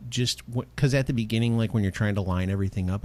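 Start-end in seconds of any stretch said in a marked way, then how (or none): none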